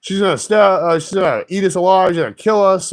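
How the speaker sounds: hammed-up fearful babble; imitating panicky excuse. Drivers excusing their flight, mock fear. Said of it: "their excuse was".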